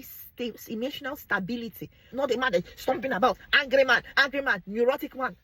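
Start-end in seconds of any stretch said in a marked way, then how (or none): 2.1-5.4 loud, higher, mocking an angry man. Vlogger mocking an angry stomping husband, loud.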